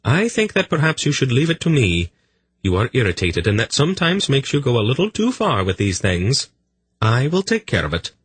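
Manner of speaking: firm and calm, lightly voiced; lightly voiced Polgara. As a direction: daughter calmly overruling her father, lightly voiced. Polgara's voice held.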